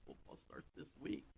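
breathy, excited whisper; whispered repeat. Pretend. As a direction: whisper it like a secret.

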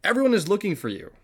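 high, loud, quick outburst; an urgent call, pitched well up; exclaiming. A narrator's reading. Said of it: "they exclaimed".

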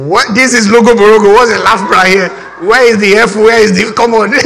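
hammed-up baffled objection; indignant disbelief. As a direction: argue in mock outrage, breaking into laughter. Newcomer protests the spelling's pronunciation.

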